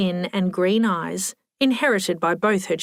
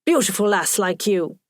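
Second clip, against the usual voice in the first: put-on thick Scottish accent. A narrator's reading. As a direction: pay a compliment in broad Scots. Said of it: "in a thick Scottish accent".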